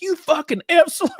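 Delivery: high, loud mock outrage; squealed playful insult; laughing mock insult. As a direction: imitate her delighted, indignant comeback. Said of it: "and she laughed".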